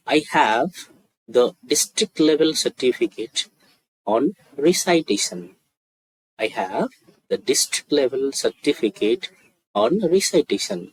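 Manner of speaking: very slow model sentence, said twice over; slow demonstration. Shown as a demonstration. Teacher models a student's achievement for practice, very slowly. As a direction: pause between phrases so learners can follow. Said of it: "আস্তে আস্তে বলছি".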